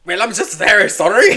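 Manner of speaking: loud, badly faked Irish accent; hammed-up Irish-ish apology; botched accent, Irish-sounding. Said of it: "somehow doing Irish".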